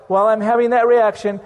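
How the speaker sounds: ordinary talk, nothing marked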